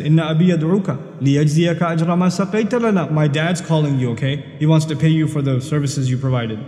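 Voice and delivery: normal talking voice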